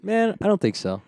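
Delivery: high-pitched, hammed-up refusal; mock reluctant refusal. Fighter mocks himself refusing to wrestle, high reluctant voice. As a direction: turn it down in a high put-on voice. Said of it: "You're like".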